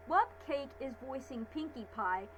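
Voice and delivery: ordinary talk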